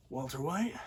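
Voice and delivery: soft questioning murmur of the name; hushed realization. Retold or imitated. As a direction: say the name quietly, dawning on you. Hank's hushed realization retold.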